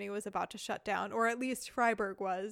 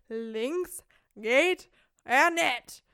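slow, louder Badisch dialect, words stretched out; slow Badisch repeat; 6 dB louder, much slower than usual. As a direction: spell it out slowly in thick Badisch. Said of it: "really strong Badisch".

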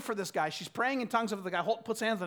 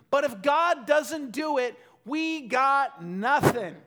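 louder, slow, weighty climax; forceful preaching; 5 semitones higher, 8 dB louder, slower than usual. Real, not acted. Preacher to congregation, slow and forceful. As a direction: land the point with full force. Preacher peaks on key point.

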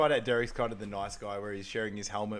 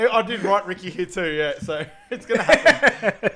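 loud, high, talking through laughter; real laughing; 8 semitones higher, 13 dB louder than usual. Real, not acted. Host owning up to a name slip, laughing. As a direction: laugh your way through the words. Cracking up over own name mix-up.

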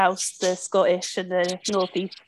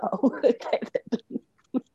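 laughing reply; 4 semitones higher, 3 dB quieter, much slower than usual. Retold or imitated. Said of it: "I was like".

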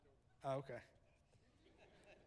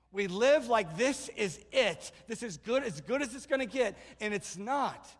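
normal talking voice

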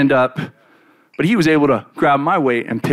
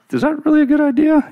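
high, squeaky worried question; mock-nervous disciple; nervous doubt; 11 semitones higher than usual. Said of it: "that I'm adding into the story".

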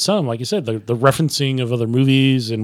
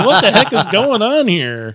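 higher-pitched mock bafflement; baffled outcry; 7 semitones higher, 4 dB louder than usual. Viewer retells confusion at Greek dancing in the film, baffled outcry. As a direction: exclaim it in amused disbelief.

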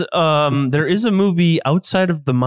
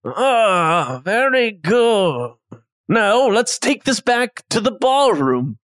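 slow, higher chef voice, pleased; approving chef; 3 semitones higher, much slower than usual. Acted. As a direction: savor the praise like a proud chef.

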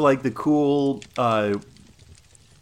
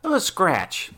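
dismissive knight; 8 semitones higher than usual. Acted. Valerius dismissive.

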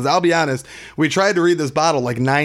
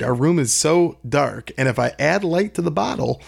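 usual speaking voice

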